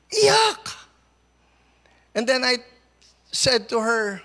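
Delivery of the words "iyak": loud, high exclamation; emphatic cry. Real, not acted. Pastor stressing to congregation, a loud high burst. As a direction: burst out the word. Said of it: "Alam niyo yung iyak".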